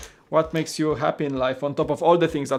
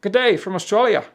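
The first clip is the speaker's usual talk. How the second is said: put-on Aussie accent, pitched up; Australian accent; 4 semitones higher than usual. Shown as a demonstration. Streamer demonstrates the accent tips in a put-on Australian greeting. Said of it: "makes you sound like Australian every time".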